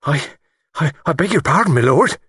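high, halting apology; stammering. A narrator's reading. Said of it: "stammered the man".

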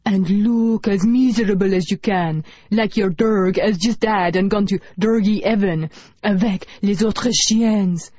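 put-on hammy French accent, slow and drawn out. A narrator's reading. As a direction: overdo a French accent for the camera. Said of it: "in a French accent".

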